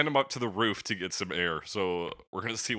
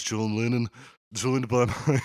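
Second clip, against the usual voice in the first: put-on Liverpool accent; 2 semitones higher, 6 dB louder, slower than usual. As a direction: Liverpool accent breaking into a laugh. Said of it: "best I can do for a Liverpool accent".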